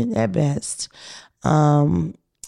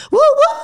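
high squealing woo woo; train whistle hoot; 7 dB louder than usual. Real, not acted. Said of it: "That woo woo went way too high".

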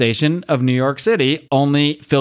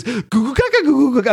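put-on high-pitched babbling baby voice; mock baby talk; 10 semitones higher than usual. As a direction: mimic French as silly baby babble. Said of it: "it always sounds like baby talk to me".

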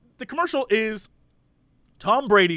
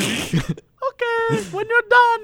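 much higher put-on wife voice; 13 semitones higher, slower than usual. Wife calling to her husband about the mattress, high put-on voice. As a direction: call out puzzled as his wife. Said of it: "He's like".

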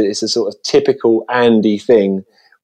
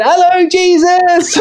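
put-on high, slow sing-song kids' chant; children's chorus; 3 dB louder, slower than usual. Imitating the pupils answering in unison, high and slow. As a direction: mimic a hall of kids chanting. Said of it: "And they went".

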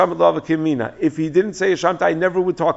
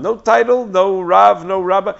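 each no punched loud and high; emphatic list; 5 semitones higher, 7 dB louder, slower than usual. Real, not acted. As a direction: hammer every no. Stressing the insult.

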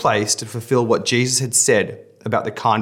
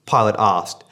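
normal talking voice

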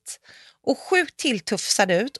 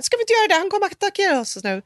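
much higher, urgent retold panic; panicked; 11 semitones higher, faster than usual. Pressing Emilia as the coyote follows, high frantic. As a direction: insist as if the wolf is pouncing. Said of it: "Jag bara".

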